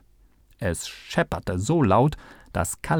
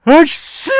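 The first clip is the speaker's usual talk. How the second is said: lautes, hohes Niesen; prustendes Niesen; 11 dB louder than usual. A narrator's reading. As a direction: explosionsartig losprusten. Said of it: "prustete der Assistent". (heftig gespieltes Hatschi)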